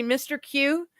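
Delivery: ordinary delivery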